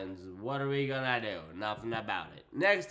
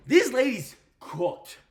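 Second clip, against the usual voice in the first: emphatic praise; 7 semitones higher, 7 dB louder, slower than usual. Real, not acted. Praising a match.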